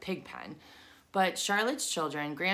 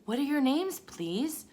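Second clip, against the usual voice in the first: trembling with joy; 9 semitones higher, 4 dB louder than usual. Acted. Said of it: "trembling with joy".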